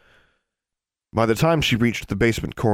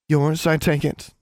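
higher, slow and cool; 8 semitones higher, slower than usual. A narrator's reading. Girl handing back a toad, coolly. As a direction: ask it coolly, drawing it out. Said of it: "Darla said coolly".

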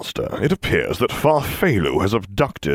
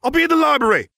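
high excited shout; 4 dB louder, faster than usual. Acted. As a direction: call it out across the room.

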